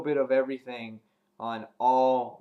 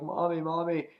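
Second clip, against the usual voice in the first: lightly voiced, higher-pitched child calling; a hint of a pleading daughter, pitched up; little girl calling mom; 6 semitones higher than usual. Beth calling to her zombie mother, lightly imitated girl voice.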